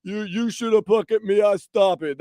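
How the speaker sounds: put-on lightly slurred drunk hockey goalie; drunk Sergei Bobrovsky. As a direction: slur the words like a happy drunk. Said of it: "slurring his words".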